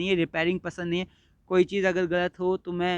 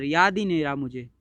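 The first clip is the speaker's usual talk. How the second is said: ordinary talk, nothing marked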